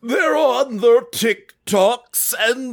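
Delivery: put-on old man voice. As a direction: grumble like an old man shaking his fist. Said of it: "Old man [name], take the stage".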